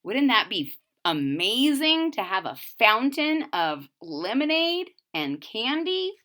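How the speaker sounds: ordinary delivery, nothing marked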